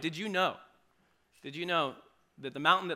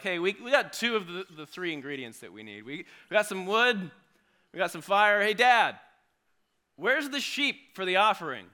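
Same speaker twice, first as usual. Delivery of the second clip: put-on eager boy, louder and higher; curious kid asking his dad; eager child; 5 semitones higher, 7 dB louder than usual. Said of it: "Isaac's starting to put it together".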